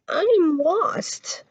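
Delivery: high, slow small creature's plea; a tiny lost creature close to tears; lost little creature. A narrator's reading. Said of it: "cried the little creature".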